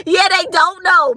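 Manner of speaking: laughing, pitched high and loud. Real, not acted. Laughing about what men don't know about rings. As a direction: laugh through it.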